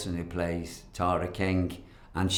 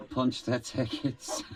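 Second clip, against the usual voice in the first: laughing; 5 semitones higher, slower than usual. Real, not acted. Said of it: "repeats with a laugh".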